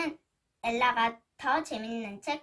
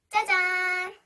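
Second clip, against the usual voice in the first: bright showy reveal, a bit louder; excited ta-da; 5 semitones higher, 6 dB louder, faster than usual. Real, not acted. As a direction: announce the surprise with flair. Big reveal to kids.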